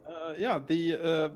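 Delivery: normal talking voice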